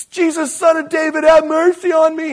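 high, loud desperate cry; yelling. Retold or imitated. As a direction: call out to Jesus over the crowd. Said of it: "And he's yelling it".

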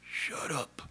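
weak whispered shut up; barely able to talk. Retold or imitated. Worn-out Job hushing his friends, a feeble whisper. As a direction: wheeze it out with no strength left. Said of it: "Job barely able to talk".

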